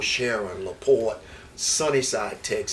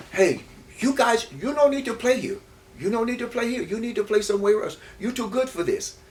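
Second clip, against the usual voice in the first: put-on retelling a pitcher in a high Cuban accent; 11 semitones higher than usual. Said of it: "He come with a Cuban accent".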